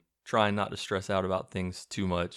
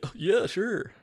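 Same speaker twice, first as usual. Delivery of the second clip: high-pitched nerdy agreement; mock nerd voice, pitched way up; imitating eager nerd; 12 semitones higher, slower than usual. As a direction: squeak a yes like an awkward nerd. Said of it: "So he's like".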